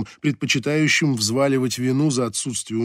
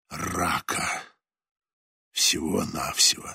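put-on low, soft, fading old sailor; dying skipper; 3 semitones lower, 3 dB quieter than usual. A narrator's reading. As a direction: speak like a dying man holding on. Voices dying skipper Leclerc.

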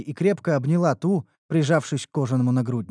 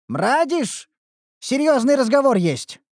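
calling out, higher and impatient; a blacksmith's impatient shout; 12 semitones higher, 5 dB louder, slower than usual. A narrator's reading. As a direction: hail him impatiently across the market. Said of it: "окрикнул его Динклер нетерпеливо".

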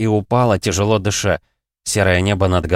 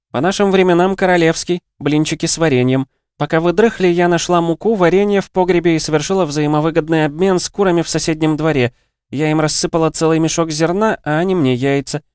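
high, energetic, louder character voice; lively high-pitched bragging; boastful cheerful friend; 10 semitones higher, 4 dB louder than usual. A narrator's reading. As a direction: show off the breakfast haul, pitched high. Voices Lera boasting about breakfast.

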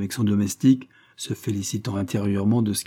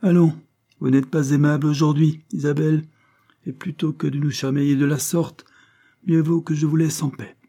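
higher, brisk-spirited brush-off with a loud start; dismissive Levison; 6 semitones higher, 4 dB louder than usual. A narrator's reading. Levison brushes off a quarrelling Isabelle, dismissive. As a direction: wave the quarrel away.

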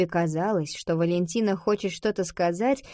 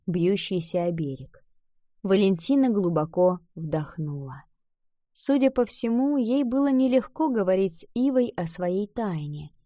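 usual speaking voice

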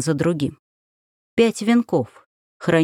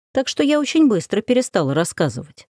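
plain reading voice

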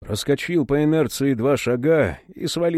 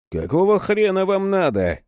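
angry; 7 semitones higher than usual. Acted. Серафим ругается на незваных гостей.

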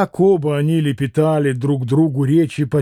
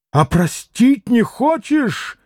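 cried out higher and slow; frantic reproach; 6 semitones higher, slower than usual. A narrator's reading. Mitya reproaches Grushenka for not forgiving, crying out. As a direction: hurl it as a desperate reproach. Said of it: "прокричал Митя ... с безумным упрёком".

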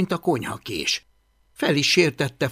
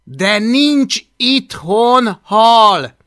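loud, slow, higher and firm; kind but firm; 8 semitones higher, 10 dB louder, much slower than usual. A narrator's reading. Boy tries to sway hungry dragon, firmly. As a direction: spell it out slowly and loudly, word by word. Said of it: "Igyekezett kedves, de határozott hangon beszélni".

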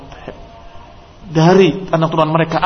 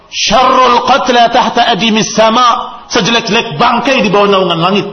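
put-on high, loud and slow, a stern old companion declaring it; Abu Umamah's loud answer; 7 semitones higher, 5 dB louder, slower than usual. Speaking to his companion, Abu Umamah loudly and slowly condemns the heads. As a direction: proclaim each word slowly, like a stern verdict. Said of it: "Yang mengantarnya berkata".

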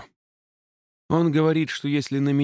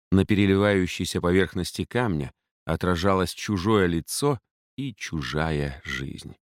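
ordinary delivery

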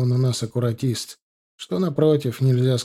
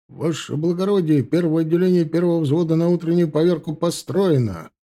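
raised, brisk report to an officer; higher-pitched soldier's roll-call report; military report; 5 semitones higher than usual. A narrator's reading. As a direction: report formally to a superior on parade. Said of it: "докладывает младший унтер-офицер Гончаров".

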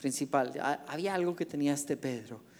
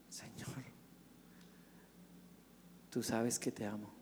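much softer, lower reply; humble answer; 5 semitones lower, 14 dB quieter than usual. Acted. Peter quietly answers Jesus' question, soft and low. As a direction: answer gently, almost under the breath.

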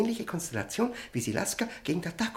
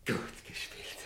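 whispered; 13 dB quieter than usual. Acted. Prisoner mutters praise to himself.

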